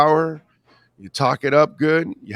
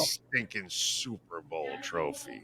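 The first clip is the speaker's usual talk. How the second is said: mock disdain; 8 semitones lower, 11 dB quieter than usual. Acting disgruntled Canadian football fan.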